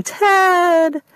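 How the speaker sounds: high, loud wail of the name. Acted. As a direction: wail his name in despair. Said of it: "She wailed out his name".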